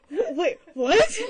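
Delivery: high, loud, stammered disbelief; confused bystander. Acted. Game master plays the confused man, baffled. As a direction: stumble into a drawn-out baffled question. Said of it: "he's just like".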